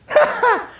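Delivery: high, loud burst of laughter; a sudden high laugh from an old woman. Acted. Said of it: "Sarah laughed to herself".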